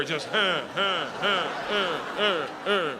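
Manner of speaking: rhythmic breathy huh sounds; comic panting; mock panting. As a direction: pant under the weight. Breathing under the bear.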